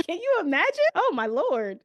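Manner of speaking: high, loud, laughing exclamation; laughing disbelief. Real, not acted. Coach reacts to her own client story, laughing in disbelief. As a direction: burst out laughing, aghast.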